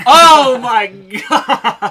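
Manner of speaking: loud, high, drawn-out "oh my God"; shocked laughing exclamation. Real, not acted. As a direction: cry out in real shock, half laughing. Shocked reaction to friend's crude confession.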